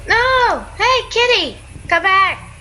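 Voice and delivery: high-pitched pleading call; high, playful protest; calling a cat back. Real, not acted. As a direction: call after a runaway kitty. Calling after a fleeing cat.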